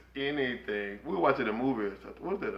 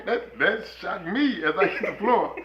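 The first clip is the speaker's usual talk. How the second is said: ordinary talk, nothing marked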